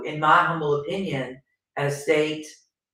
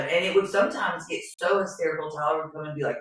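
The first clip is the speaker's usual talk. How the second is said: normal talking voice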